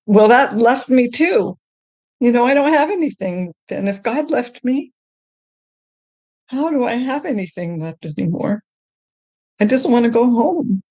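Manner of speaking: high-pitched, forlorn retold lament; plaintive despair. Retells her despair at losing God.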